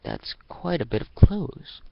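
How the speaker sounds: light high surprised voice; amazed at the pile of clothes; surprised remark. Acted. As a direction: raise the pitch, a bit taken aback.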